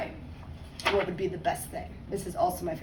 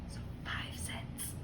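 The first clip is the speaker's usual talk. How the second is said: soft whispered explanation; whispered aside; 16 dB quieter, much slower than usual. Real, not acted. Teacher explaining a nickel to the class in a whisper. Aside to children mid-reading.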